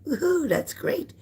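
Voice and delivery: high, slow woohoo of cheer; excited woohoo. Real, not acted. Cheering a viewer on her lunch break, high and drawn out.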